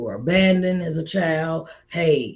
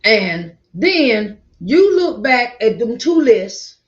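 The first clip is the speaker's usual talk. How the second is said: emphatic preaching; 6 semitones higher, 8 dB louder, slower than usual. Real, not acted.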